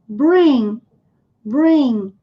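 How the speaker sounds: "bring" with no G at the end, just the nasal; silent g. Shown as a demonstration. Teacher demonstrates to students: "bring" with silent G. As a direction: drop the G: "bring" ends in the N sound. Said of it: "G mudo".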